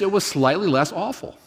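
normal talking voice

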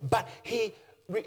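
ordinary talk

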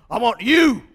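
Uncle Sam poster voice. Retold or imitated. Preacher recalling the World War Two posters, booming Uncle Sam call. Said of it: "iconic recruiting posters".

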